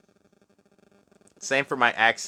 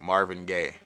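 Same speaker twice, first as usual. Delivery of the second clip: ordinary talk, nothing marked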